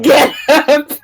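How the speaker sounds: tough love order. Acted. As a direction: snap her out of it like a drill coach. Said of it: "put on the tough love glove".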